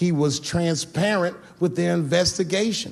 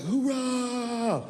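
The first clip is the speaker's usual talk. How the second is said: cheering; 8 semitones higher, 5 dB quieter than usual. Retold or imitated. Drunk bar crowd cheering.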